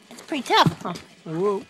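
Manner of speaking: ordinary talk, nothing marked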